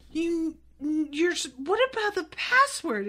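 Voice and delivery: mock sputtering, rising high in disbelief; stammer, then pitch up the question; imitated aghast teacher; flabbergasted. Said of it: "she's like".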